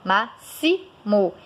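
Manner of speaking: "máximo" with X as an s sound, MA-si-mo; correct pronunciation. Shown as a demonstration. Teacher models for learners how it is said, slow "máximo" with s. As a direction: say "máximo" slowly, syllable by syllable, middle one "si". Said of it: "você fala máximo".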